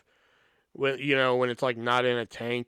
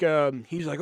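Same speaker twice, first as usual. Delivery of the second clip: ordinary talk, nothing marked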